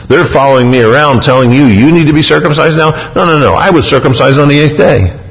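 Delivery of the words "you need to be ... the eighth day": normal talking voice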